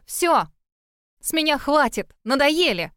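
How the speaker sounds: sharp, high furious cry; fierce shout. Acted. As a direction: yell it out, fed up. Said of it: "свирепо вскричала".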